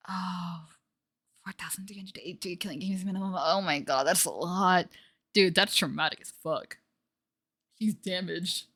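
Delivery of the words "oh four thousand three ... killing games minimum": hushed unvoiced whisper; stunned whisper; awed whisper. Real, not acted. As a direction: whisper the number in disbelief. Whispered in awe.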